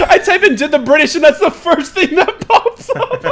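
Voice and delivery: high, loud, helpless laughing; loud talk breaking into laughter. Real, not acted. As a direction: squeal it out while cracking up. Cracking up at search result.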